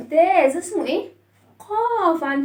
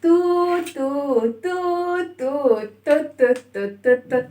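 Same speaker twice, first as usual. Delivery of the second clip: long toots, then short chugging toots; two-note train toots breaking into quick short ones; train whistle imitation; slower than usual. Shown as a demonstration. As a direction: hoot like a train for the children. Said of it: "فاكرين صوت القطار عامل ازاي؟".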